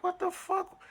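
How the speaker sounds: very high squeaked what the fuck; squeaky disbelief. Retold or imitated. Told to turn everything off, squeaky shocked reply. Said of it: "I'm like".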